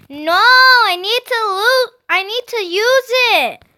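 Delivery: put-on high, strained childish wail; yelling little sister. A narrator's reading. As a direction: yell stubbornly like a small child. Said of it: "We yelled at each other".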